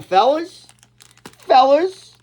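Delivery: high, loud stretched "fellas"; hyped-up falsetto "fellas"; excited call. Real, not acted.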